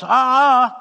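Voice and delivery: high, very loud objecting noise; loud imitated "uh-uh". Somebody in the checkout line objects, loud high "uh-uh". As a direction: blurt a big wordless objection.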